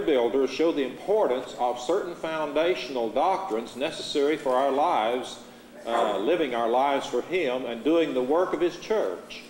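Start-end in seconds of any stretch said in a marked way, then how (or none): none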